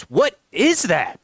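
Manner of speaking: astonished friend. Acted. Roommate's friend walks in and sees ketchup, astonished. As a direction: stretch it out in amazement.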